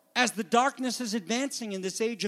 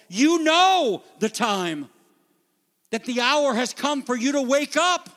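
louder, higher exhortation; fiery preaching; 5 semitones higher, 5 dB louder than usual. Real, not acted. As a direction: urge the church to wake up. Preaching at full force.

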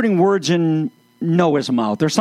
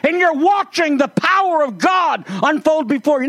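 higher, awed voice of Jesus proclaiming God's power; reverent proclamation; 7 semitones higher than usual. Acted. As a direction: announce the miracle with holy wonder.

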